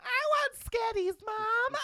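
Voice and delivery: put-on shrill, very high screaming child demanding candy; screaming kid. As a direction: scream it like a spoiled kid on a plane. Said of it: "a kid behind me just going".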